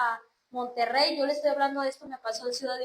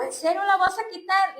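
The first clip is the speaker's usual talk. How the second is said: higher-pitched challenge, quoting the prophet; retold incredulous question; 6 semitones higher, 4 dB louder than usual. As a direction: ask as if doubting her resolve. Profeta la interroga, incrédulo. (pregunta incrédula, citando al profeta)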